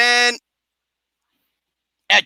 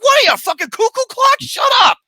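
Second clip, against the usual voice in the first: high, loud, fast outburst; retold incredulous shout; exasperated heckling; 13 semitones higher, 4 dB louder, faster than usual. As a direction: yell back at an idiotic film. Retells his yelling at the film.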